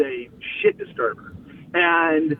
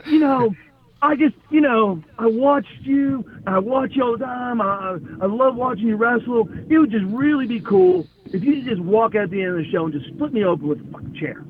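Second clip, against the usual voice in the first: higher-pitched imitation of a gushing fan; eager admirer; 6 semitones higher than usual. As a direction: plead like a star-struck colleague asking a favour. Acted eager fan's strange request.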